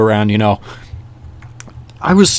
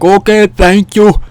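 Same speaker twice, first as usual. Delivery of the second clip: higher, slow imitated Russian accent; 8 semitones higher, 4 dB louder, slower than usual. Stranger thanking them for answers, slow mock Russian accent. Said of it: "with a thick Russian accent".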